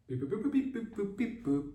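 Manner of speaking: high-pitched voiced keypad beeps; quick mock phone dialing. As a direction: beep and boop like dialing a phone.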